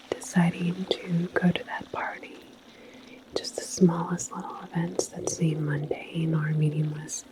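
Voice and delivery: ordinary talk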